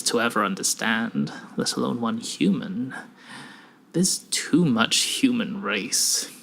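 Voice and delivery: ominously calm stranger. Acted. Stranger recites researcher's erased life, calm and ominous. Voices Gray's ominous monologue.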